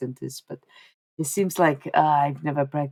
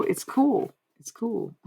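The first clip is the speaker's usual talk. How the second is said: ordinary talk, nothing marked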